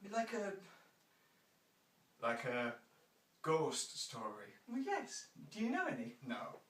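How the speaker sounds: normal talking voice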